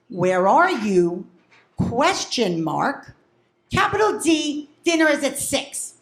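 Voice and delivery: slow, deliberate dictation; dictating a text. Retold or imitated. Mother reading out her text to her son, deliberate. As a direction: spell out each piece of the message slowly.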